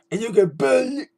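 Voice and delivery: lightly voiced high catchphrase; hint of a high mock wrestler voice; imitating Bo Dallas. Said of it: "with a high-pitched voice like that".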